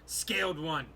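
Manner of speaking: a stern orc addressing the stranger; lightly voiced orc. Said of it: "the orc say".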